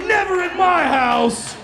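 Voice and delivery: high, slow, loud mock vow; mock a stern owner; high slow proper refusal; prim owner. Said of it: "these guys were like".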